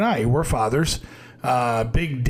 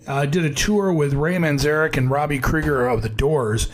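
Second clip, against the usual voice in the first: ordinary talk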